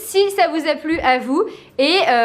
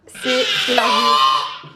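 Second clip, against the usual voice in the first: drawn-out shout; 4 semitones lower, much slower than usual. Real, not acted. Game lost, shout.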